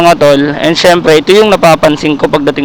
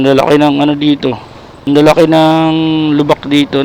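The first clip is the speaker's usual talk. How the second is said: normal talking voice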